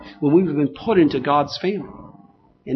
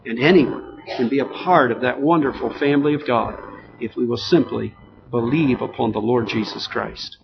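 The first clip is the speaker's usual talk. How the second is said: normal talking voice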